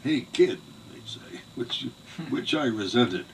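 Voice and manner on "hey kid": put-on loud, higher brash call of Hey kid; a teasing Bronx relative calling to a boy; mocking city relatives. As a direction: imitate a condescending New York uncle. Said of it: "they used to call me kid".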